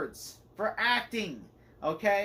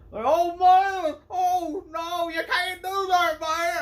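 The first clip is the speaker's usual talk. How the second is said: much higher, loud mock panic at the screen; exasperated outcry; 11 semitones higher, 8 dB louder, much slower than usual. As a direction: yelp it like a horrified viewer. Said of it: "watching this going, like".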